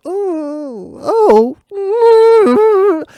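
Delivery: very high squealed oh, swelling into a whimper; tearful, high-pitched stifled squeal; desperately suppressed laughter. Retold or imitated. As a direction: act out a laugh trapped inside. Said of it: "I wanna laugh so hard".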